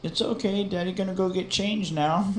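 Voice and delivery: put-on high, soft baby-talk reassurance; imitated parent comforting a toddler; soothing dad. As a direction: reassure a small child in a lifted voice. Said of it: "And I was just like".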